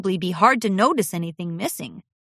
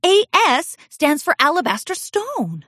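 brightened up; 9 semitones higher than usual. A narrator's reading. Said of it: "Cadance brightened".

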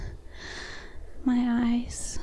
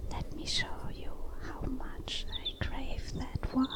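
soft, intimate whisper; 3 semitones higher, 9 dB quieter than usual. Acted. Woman leaning close to her lover's ear, whispering. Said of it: "I lean closer to whisper into your ear".

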